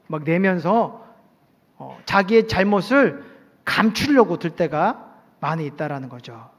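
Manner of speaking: usual speaking voice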